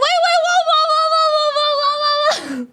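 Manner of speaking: high, loud, stunned string of whoas; shocked whoa. Retold or imitated. Fufu reacting to the drink name with a high string of whoas. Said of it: "And then Fufu's like".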